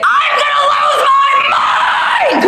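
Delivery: high, slow shouted correction; shouted fix. Acted. Covering her cuss to the kid, shouting. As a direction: swap the curse, yell it out. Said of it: "I didn't mean to cuss".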